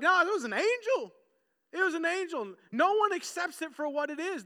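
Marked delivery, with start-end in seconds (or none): 0.0-2.5 insistent bystander. Retold or imitated. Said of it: "Others are like".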